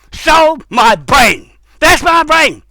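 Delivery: drawn-out, rising indignation; indignant outburst. Real, not acted. Speaker protesting the theft of ideas, indignant outburst. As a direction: claim it back angrily. Angry claim of being robbed.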